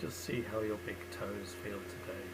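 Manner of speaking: unhurried body-scan guidance with long pauses; calm meditation guide, leaving space after each phrase; guided meditation. Real, not acted. Leading listeners through a lying-down body scan, guided meditation.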